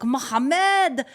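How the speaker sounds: imitated small boy yelling a name, high and loud. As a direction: cheer it in a child's Arabic accent. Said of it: "didn't he have the sweetest voice, like a proper Arabic voice, accent".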